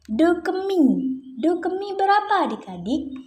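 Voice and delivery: high, lilting question to little ones; singsong quizzing. Pretend. Teacher asks young pupils about do to mi, singsong quizzing. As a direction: call the question out high for the class. Quizzing children on note intervals.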